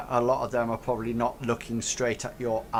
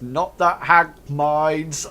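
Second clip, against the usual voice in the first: raised, drawn-out heckle; slow, louder jibe from the crowd; heckling ruffian; 4 semitones higher, 7 dB louder, much slower than usual. Acted. Another ruffian chiming in on the joke, slow loud heckle. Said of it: "somebody else says".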